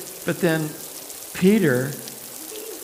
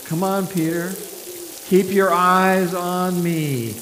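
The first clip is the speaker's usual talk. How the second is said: coaxing; 5 semitones higher, 4 dB louder, slower than usual. Acted. Jesus coaxing Peter on water.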